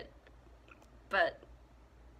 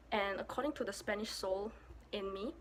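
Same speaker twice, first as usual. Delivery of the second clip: usual speaking voice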